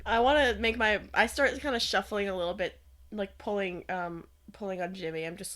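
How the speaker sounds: ordinary delivery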